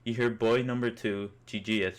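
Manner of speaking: ordinary delivery, nothing marked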